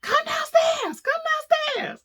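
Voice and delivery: high panicked shout to come downstairs; frantic yell. Retold or imitated. Calling husband down, high panicked yell. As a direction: yell as if your life depends on it. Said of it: "I'm like".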